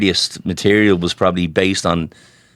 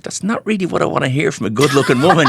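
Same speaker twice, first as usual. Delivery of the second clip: higher, laughing mock complaint; laughing protest; 7 semitones higher than usual. To the girl at the bar, laughing. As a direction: laugh it off, pitched up. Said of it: "And I was like".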